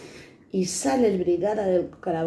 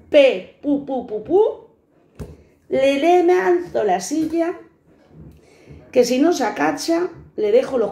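higher, louder, slow furious retelling; 6 semitones higher, 3 dB louder, slower than usual. Retold or imitated. Luisa telling viewers how she threw the chair, furious. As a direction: relive the rage as you throw it. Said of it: "me cojo una mala hostia".

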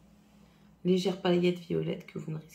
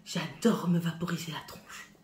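whisper; 3 semitones lower, 3 dB quieter than usual. Real, not acted. Whispered while spraying her face.

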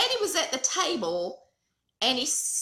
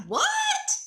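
startled what; 4 dB louder than usual. Retold or imitated. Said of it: "Mama was like".

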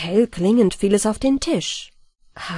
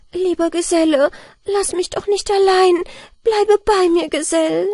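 louder, higher pleading through tears; tearful plea, louder and higher; 10 semitones higher, 4 dB louder than usual. A narrator's reading. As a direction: beg a dying friend to stay. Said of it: "Mitleidstränen ... und sie rief".

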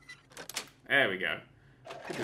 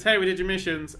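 mock cheery report; 6 semitones higher, 9 dB louder than usual. Imagined greeting to quest givers.

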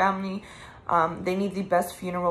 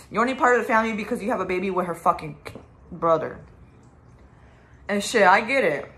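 ordinary talk, nothing marked

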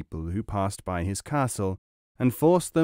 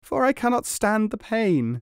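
crying out in pain; 10 semitones higher than usual. A narrator's reading. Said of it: "he cried".

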